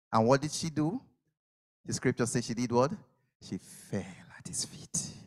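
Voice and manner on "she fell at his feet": slow, hushed whisper. Acted. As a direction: drop to a reverent whisper. Preacher hushes the scene.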